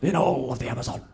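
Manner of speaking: Attenborough impression. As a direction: marvel at the waterfall like a nature narrator. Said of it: "He'll be like".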